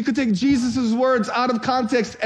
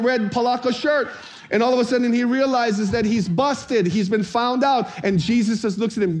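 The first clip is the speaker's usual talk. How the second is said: normal talking voice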